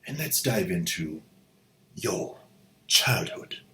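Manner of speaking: put-on soft, snooty whispered upper-class accent; hoity-toity posh accent. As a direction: purr it like a pampered aristocrat. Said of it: "all hoity-toity accents aside".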